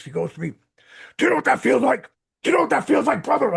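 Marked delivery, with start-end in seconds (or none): 1.2-3.5 loud, high wrestler shout; Road Warrior yell. Retold or imitated. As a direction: bellow it like a wrestling promo. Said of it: "loudest Road Warrior voice".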